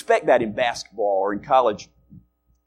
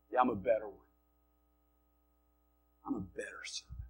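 soft, low smack-talk comeback; understated boast; 3 semitones lower, 12 dB quieter, faster than usual. Acted. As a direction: drop the brag quietly after the challenge. Boast dropped after loud challenge.

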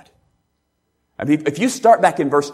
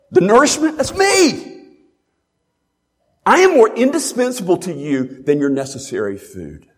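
preaching at full force; 4 dB louder than usual. Acted. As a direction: proclaim Jesus' words with fervour. Preacher voices Jesus' claims.

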